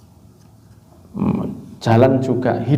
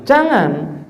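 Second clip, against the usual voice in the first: ordinary delivery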